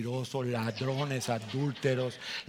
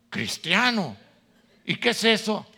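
much louder, higher disbelief; incredulous wife; 6 semitones higher, 9 dB louder than usual. Acted. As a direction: scoff in disbelief. Wife's incredulous reaction.

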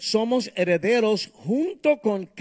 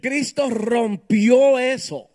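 loud, slow and emphatic; emphatic preaching; 4 semitones higher, 7 dB louder, slower than usual. Real, not acted. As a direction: proclaim it with force.

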